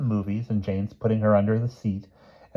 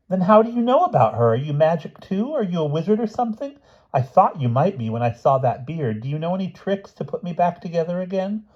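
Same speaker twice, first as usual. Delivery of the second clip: high-pitched eager little questioner; Martha, eagerly curious; 10 semitones higher than usual. A narrator's reading. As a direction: fire off hopeful questions up high.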